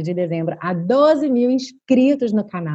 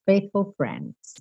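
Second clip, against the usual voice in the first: quiet repeat; 6 dB quieter, much slower than usual. Shown as a demonstration. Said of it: "pro F".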